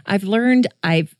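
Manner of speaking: usual speaking voice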